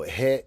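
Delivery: he said like heh, short open e; Lakota question ending he. Shown as a demonstration. Teacher demonstrating the Lakota ending he, clearly. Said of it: "is the female question ending".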